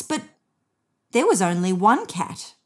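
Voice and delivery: usual speaking voice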